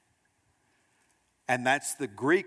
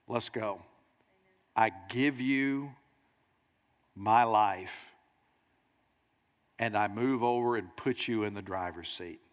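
low, hushed, with long pauses; solemn surrender; 4 semitones lower than usual. Acted. Convicted sinner surrenders to Jesus.

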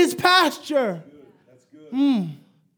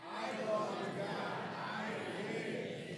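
congregation answering far off; 4 semitones lower, 21 dB quieter than usual. Real, not acted. Congregation repeats back.

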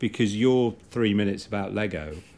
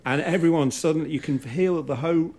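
normal talking voice

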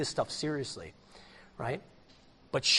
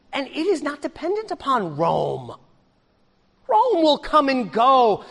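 high, forceful sermon delivery; pitched-up pulpit proclamation; impassioned preaching; 10 semitones higher, 6 dB louder than usual. Real, not acted. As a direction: preach it with rising intensity.

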